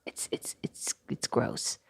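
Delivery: soft, breathless whispered disgust; imitating a husband hissing his verdict at the table. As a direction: whisper it like a fussy old granny. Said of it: "making him sound like a little California granny".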